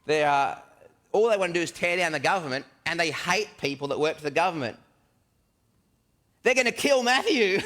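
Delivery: builds from protest to a high, loud panicked cry; mounting alarm. Acted. Thomas panicking silently as Jesus picks the Zealots, building to a high, loud cry.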